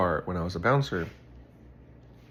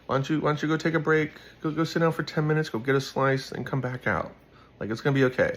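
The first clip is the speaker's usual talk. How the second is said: reassuring buddy; 7 semitones higher than usual. Retold or imitated. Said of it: "I'm like".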